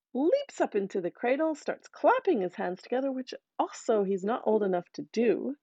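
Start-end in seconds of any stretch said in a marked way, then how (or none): none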